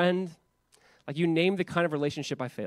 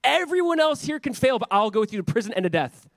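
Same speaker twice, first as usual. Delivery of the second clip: high, loud, eager vow of loyalty; boastful Peter; 7 semitones higher, 4 dB louder than usual. Retold or imitated. Pastor voicing Peter boasting to Jesus, eager and loud. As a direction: swagger through the promise like Peter. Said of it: "like, enthusiasm of".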